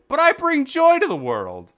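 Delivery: grand boast. Acted. Boasting in character voice.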